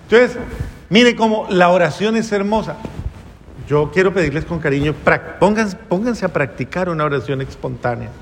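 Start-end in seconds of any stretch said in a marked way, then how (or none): none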